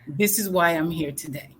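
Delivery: ordinary talk, nothing marked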